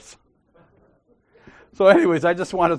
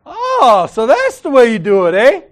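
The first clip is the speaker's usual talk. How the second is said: loud, high mock "oh, I see"; a loud knowing jab; sarcastic realization; 6 semitones higher, 10 dB louder than usual. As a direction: act like it finally clicks, loud and knowing. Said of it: "And I'm like".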